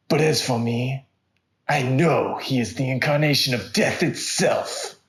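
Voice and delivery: loud, heavy movie-trailer narration; dramatic narration. A narrator's reading. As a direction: boom it out like an action trailer. Dramatic trailer-style story opening.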